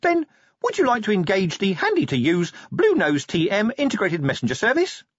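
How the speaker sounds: high salesman-like imp; eager imp voice. Acted. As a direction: pitch it brightly, high. Imp character voice.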